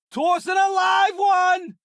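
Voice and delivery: high, urgent yell; shout. Acted. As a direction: holler it across the ship. Calling out in combat.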